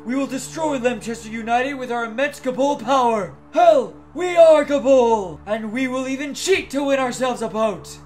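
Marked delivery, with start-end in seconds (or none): 3.5-5.4 loud, drawn-out team cry; rallying battle cry. Acted. Player rallying the team against Lemchester, shouting a battle cry.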